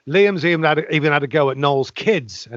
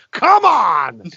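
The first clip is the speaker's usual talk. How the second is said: high, louder cry of disbelief; exasperated exclamation; 7 semitones higher, 4 dB louder than usual. Real, not acted. Podcast host reacting to a rock star's petition, exasperated. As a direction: burst out with indignant disbelief.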